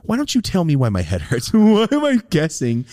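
high, incredulous complaint climbing to a loud squeal; mock exasperation. Patient questioning the doctor's guesswork, mounting mock exasperation. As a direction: protest in exaggerated disbelief. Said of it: "that's when I thought".

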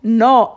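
"No" with a pure, steady O; Italian no. Shown as a demonstration. Guest showing the Italian word, pure-vowelled "No". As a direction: cut "No" off before any U glide. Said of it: "how you would say it in Italian".

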